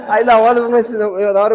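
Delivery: loud, real laughter breaking through the words; bursting out laughing. Real, not acted. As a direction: laugh out loud while telling it. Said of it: "eu comecei a rir".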